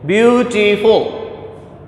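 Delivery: beautiful drawn out slowly, each syllable apart; slowly sounded out. Shown as a demonstration. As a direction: stretch out Beautiful so every vowel sound is heard. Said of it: "It has three sounds".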